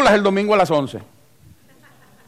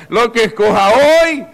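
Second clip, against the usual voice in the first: slow, louder warning through laughter; laughing warning; 4 semitones higher, 6 dB louder, slower than usual. Real, not acted. Pastor warns single members while laughing, slow and loud. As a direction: warn them while still laughing.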